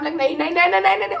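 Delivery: rising, panicky string of no's; panicked refusal. Retold or imitated. Refusing to move ankle. (डर से बार-बार मना करना)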